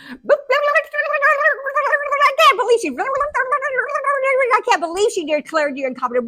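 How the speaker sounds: high, loud garbled tirade; hammed-up hysterics, words tumbling into gibberish; mock meltdown at full pitch; hysterical rant. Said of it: "I was so upset".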